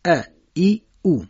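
ا، ای، او pronounced a, i, u, each set apart; Arabic short vowels. Shown as a demonstration. Teacher summing up for listeners, distinct Arabic vowels. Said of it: "تلفظ عربیش هست".

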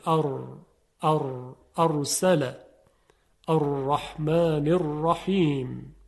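«ار» and «الرحمن الرحیم» with a light tongue-tip trill on r; rolled ra demo. Shown as a demonstration. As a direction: tap the r once or twice, slowly. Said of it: "تکرار و ارتعاش در سر زبان". («ر» با لرزش خفیف سر زبان)

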